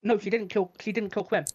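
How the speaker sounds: ordinary delivery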